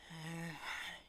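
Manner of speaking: soft breathy sigh; acted sigh of patience; weary sigh. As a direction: let out a long quiet sigh. Driver sighs before answering.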